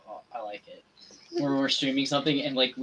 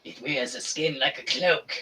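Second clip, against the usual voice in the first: scary voice. Acted. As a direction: menace it like a horror villain. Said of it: "I like the scary voice I did".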